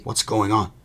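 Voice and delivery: loud angry growl. Acted. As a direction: demand it with a growl. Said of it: "growled Uncle Vernon".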